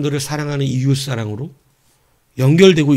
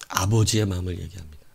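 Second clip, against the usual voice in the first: much lower and softer, gentle; tender; 8 semitones lower, 7 dB quieter than usual. Acted. Preacher introduces God's fatherly heart, softly.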